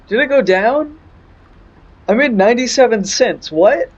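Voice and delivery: high-pitched stunned repeat of the question; incredulous. Real, not acted. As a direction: ask it again as if you can't believe it. Repeats in disbelief.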